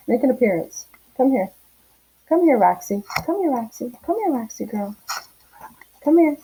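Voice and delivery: sing-song coax to a shy dog; calling the dog. Real, not acted. Coaxing her dog onto camera.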